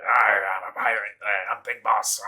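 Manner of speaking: loud, growled "arr" pirate impression; mock pirate. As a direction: play a hammed-up pirate leader. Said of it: "literally sounds like a pirate".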